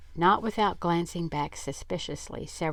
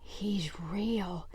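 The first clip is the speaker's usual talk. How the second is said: croaking awed whisper; dry-throated whisper of disbelief; croaked; 4 semitones higher, slower than usual. A narrator's reading. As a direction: croak it out, stunned. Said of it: "and croaked out".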